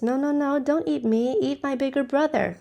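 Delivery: high pleading little goat voice; little goat pleading with the troll, high and begging. A narrator's reading. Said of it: "And the little one says".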